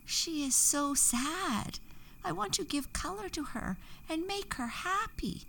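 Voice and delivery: small fairy begging to help the flower; fairy pleading. A narrator's reading. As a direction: beg softly as the little fairy. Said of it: "Daffodilia said".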